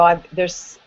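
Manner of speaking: ordinary talk, nothing marked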